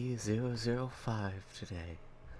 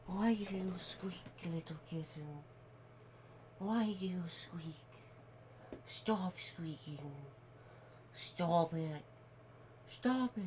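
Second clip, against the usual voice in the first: higher, softer scolding; scolding a cat; 5 semitones higher, 5 dB quieter, slower than usual. Real, not acted. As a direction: tell the kitty off.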